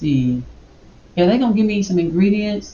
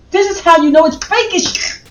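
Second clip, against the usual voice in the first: heated rant; 8 semitones higher than usual. Real, not acted. Ranting about climate change.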